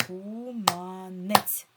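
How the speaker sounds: КУМАНЕЦЬ loud, in three slow beats KU-MA-NETS; syllable by syllable. Shown as a demonstration. Teacher has pupils count syllables, syllable by syllable. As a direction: chant КУМАНЕЦЬ evenly, one clap per syllable. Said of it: "Слово ділиться на скільки складів".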